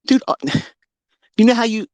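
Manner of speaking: ordinary talk, nothing marked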